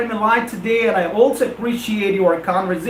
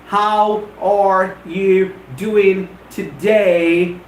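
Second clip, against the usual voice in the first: very slow, a gap between words; five individual words; much slower than usual. Shown as a demonstration. Teacher timing the written English version, five individual words. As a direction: give each word its own full beat. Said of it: "I never treat them as five individual words".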